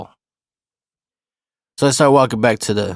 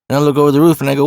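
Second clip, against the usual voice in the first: ordinary delivery, nothing marked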